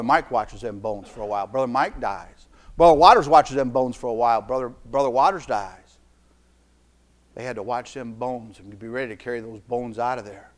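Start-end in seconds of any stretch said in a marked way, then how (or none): none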